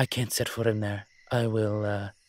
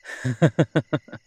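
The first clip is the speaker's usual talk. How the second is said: laugh; 3 semitones higher, 7 dB louder than usual. Acted. Delighted at the working headset.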